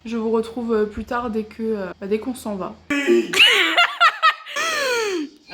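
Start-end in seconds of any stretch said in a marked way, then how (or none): 2.8-5.3 high squealing laugh of disgust; shrieking laugh. Real, not acted. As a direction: burst out in a shrill laugh.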